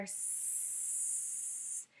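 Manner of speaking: long unvoiced hissing s; snake hiss. Shown as a demonstration. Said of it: "slithery snake".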